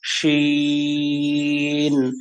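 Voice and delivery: শিন held out long, chanted; long drawn shin. Shown as a demonstration. Teacher demonstrating a long held শিন. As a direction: stretch শিন for three counts. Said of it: "তিন seconds".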